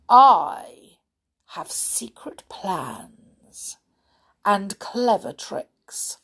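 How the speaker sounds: loud "I", then a slow whisper; sly, drawn-out crocodile; scheming crocodile. Acted. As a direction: confide the plot slowly and slyly. Crocodile figure in story.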